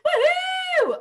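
high, loud woohoo cheer. Acted. Teacher cheering for the duck's very own bath, high and loud. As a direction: throw your voice up in a big cheer.

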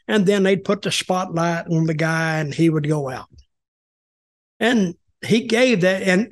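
ordinary delivery

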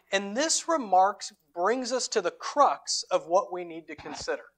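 usual speaking voice

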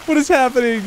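rally the friends in a panic; frantic yelling. Real, not acted. Frantic in chaos.

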